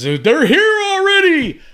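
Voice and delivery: high, loud, drawn-out movie-hero yell; imitated screaming. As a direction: scream it like the panicked man in the film. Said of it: "screaming his head off".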